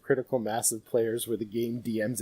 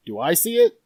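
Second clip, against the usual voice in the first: high, loud rising question; mock eager asking; 8 semitones higher, 11 dB louder than usual. Next player in a big party tries the same check, mock eager asking. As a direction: chime in as the next hopeful player.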